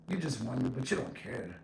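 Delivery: ordinary talk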